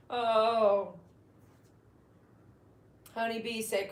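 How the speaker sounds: normal talking voice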